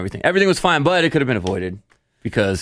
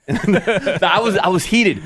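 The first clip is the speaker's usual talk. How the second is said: laughing, higher; 9 semitones higher, much slower than usual. Real, not acted. Dad laughing about losing his temper, higher with laughter.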